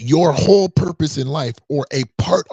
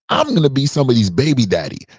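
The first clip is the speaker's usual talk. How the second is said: ordinary delivery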